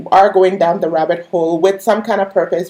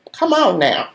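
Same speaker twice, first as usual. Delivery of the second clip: ordinary delivery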